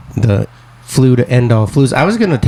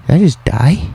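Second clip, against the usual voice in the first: mock-bewildered, higher-pitched question; acted disbelief; 5 semitones higher than usual. Sick person recalling the sudden cold sweat, mock bewilderment. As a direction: retell it as a baffled, raised-pitch question. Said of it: "And I was like".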